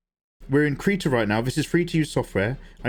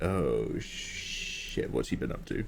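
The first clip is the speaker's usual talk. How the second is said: low, hushed dread, dropping to a whisper; hushed awed reaction; 10 semitones lower, 9 dB quieter, much slower than usual. Acted. Voicing a stunned reader on finding the skulls, hushed. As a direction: whisper the swear, then stay low and quiet. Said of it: "So you're just like".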